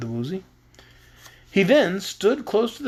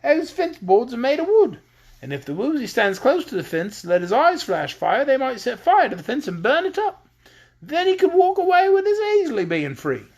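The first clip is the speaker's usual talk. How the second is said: put-on higher, excited patchwork girl; gleeful; 8 semitones higher than usual. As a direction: rattle off the plan with glee. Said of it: "dancing with glee".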